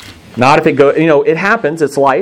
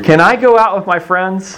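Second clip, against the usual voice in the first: put-on high, wheedling kid asking to go out; teen wheedling dad for permission; pleading teen; 10 semitones higher than usual. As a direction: beg sweetly in a much higher voice.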